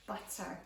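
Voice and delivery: "butter" whispered with a popped b; soft whispered "butter", b sound stressed. Shown as a demonstration. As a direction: breathe out "butter", leaning on the b. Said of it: "start with the same sound".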